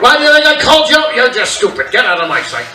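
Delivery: loud mock-angry dad; dad scolding. Irritated dad loudly sending his son away. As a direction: snap at the kid.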